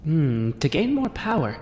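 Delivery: Tasha. Acted. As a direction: muse knowingly as an old hand at power. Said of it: "Tasha just kinda shrugs and she says".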